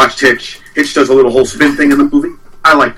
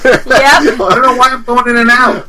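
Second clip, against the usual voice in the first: real laughter through the words, pitch climbing; high, drawn-out, laughing complaint; laughing disbelief; 11 semitones higher, slower than usual. Real, not acted. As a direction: protest while cracking up. Laughing over the botched take.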